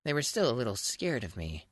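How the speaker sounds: ordinary talk, nothing marked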